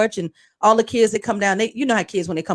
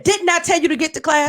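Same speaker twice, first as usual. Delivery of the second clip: higher-pitched retold scolding; mock stern telling-off; in-her-face scolding; 7 semitones higher than usual. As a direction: imitate a principal confronting a student. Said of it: "this close in her face and said".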